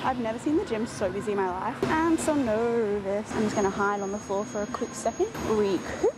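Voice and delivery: ordinary talk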